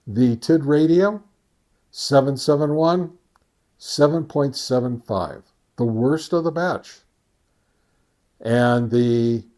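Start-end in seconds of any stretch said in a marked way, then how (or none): none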